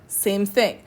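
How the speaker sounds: ordinary talk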